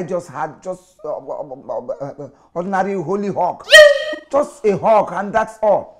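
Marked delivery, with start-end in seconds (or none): 2.5-6.0 high, loud defensive insistence; loud protest. Acted. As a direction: protest loudly that it was innocent. Husband defends himself to wife.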